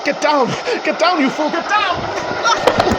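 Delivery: urgent shout. Acted. Walker yells at himself to dive clear of the train, high urgent shout. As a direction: yell the warning as the train hits.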